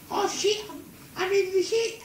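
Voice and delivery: very high-pitched cry, retold; comic dismay. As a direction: squeal it in mock horror. Mum dismayed, covered in poo.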